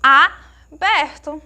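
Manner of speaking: aberto drawn out, split after a strong "a"; open sound. Shown as a demonstration. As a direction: stretch the first "a" of aberto wide open. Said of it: "nós percebemos que é um som". (aberto com "a" bem alongado)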